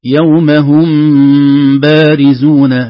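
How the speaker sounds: "هم بارزون" with the mim hidden and nasal before the ba, very slow and loud; labial hiding. Shown as a demonstration. Teacher reciting slowly to show ikhfa shafawi. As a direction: hum the mim into the ba. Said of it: "كلمة".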